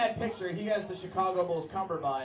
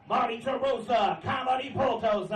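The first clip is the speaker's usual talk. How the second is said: loud tough-guy announcement, higher; tough guy shout; 6 semitones higher, 5 dB louder than usual. Pretend. Comic's tough-guy nickname, announcer shout. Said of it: "come off as, like, a real tough guy".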